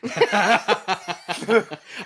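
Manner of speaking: high rising giggle; real burst of laughter. Real, not acted. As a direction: crack up at the joke. Joke about phone number.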